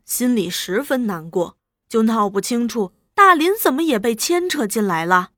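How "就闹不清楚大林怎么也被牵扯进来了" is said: plain reading voice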